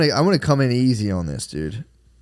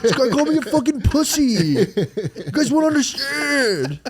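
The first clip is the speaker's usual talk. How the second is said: high, whiny, overwrought dad; stressed whiny dad; 11 semitones higher than usual. Pretend. Dad blaming comment trolls to his family, high and whiny. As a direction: whine about internet insults, way up high.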